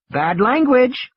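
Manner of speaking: put-on high, slow, prim child's reproach; scolding little girl. High, slow, scolding child voice. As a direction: Lex scolds Ed Regis for swearing. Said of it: "wagging her finger at him".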